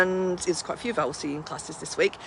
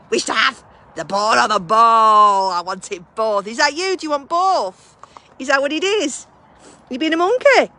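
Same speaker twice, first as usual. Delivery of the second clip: playful dog talk; 3 semitones higher, 7 dB louder than usual. Real, not acted. Talking to the dog.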